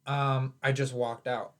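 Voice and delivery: usual speaking voice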